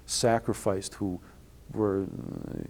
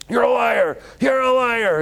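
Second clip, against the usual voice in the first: high, loud furious shout; screaming rabbi; 14 semitones higher, 7 dB louder, slower than usual. Retold or imitated. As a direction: scream the accusation at him. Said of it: "he starts yelling at".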